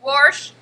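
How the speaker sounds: "wash" as "warsh", loud; loud, self-mocking "warsh" for "wash"; accented "wash". Shown as a demonstration. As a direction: punch out "wash" with an R before the sh. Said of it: "in my lovely, horrible accent".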